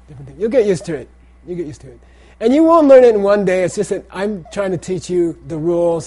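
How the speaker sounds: ordinary delivery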